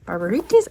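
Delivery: "Barbarites" as bar-ba-REE-teez, questioning; unsure pronunciation. Real, not acted. Reader unsure how to say a title, questioning pronunciation. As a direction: end "Barbarites" in a rising "ee-teez". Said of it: "I don't know how to say this".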